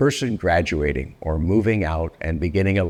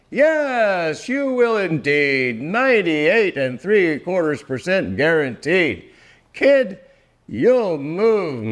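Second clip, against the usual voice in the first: rising excitement; 8 semitones higher, slower than usual. Acted. Cheering climax of the poem.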